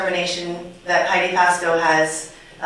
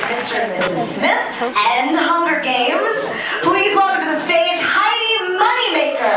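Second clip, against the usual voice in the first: announcer; 8 semitones higher than usual. Acted. Host introducing the presenter.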